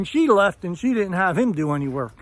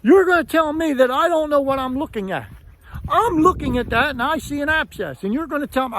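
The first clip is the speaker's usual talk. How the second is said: imitating yelling; 7 semitones higher than usual. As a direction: play the furious dentist shouting down at you. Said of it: "he starts yelling at me".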